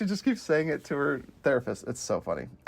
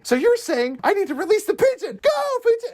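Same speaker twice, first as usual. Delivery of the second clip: higher and louder shout of go pigeon; mock excited cry; 3 dB louder than usual. Retelling the therapist scene, a high mock cheer. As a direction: hype it up like a cheer. Acted quote, pitch jump.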